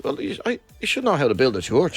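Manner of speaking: ordinary talk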